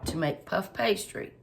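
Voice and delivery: ordinary talk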